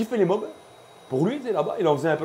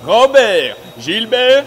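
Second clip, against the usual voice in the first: loud high crazy voice; wild unhinged ranting; 8 semitones higher, 12 dB louder, much slower than usual. Retold or imitated. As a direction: shout names like a madman. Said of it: "Avec sa voix de fou". (voix de fou criée)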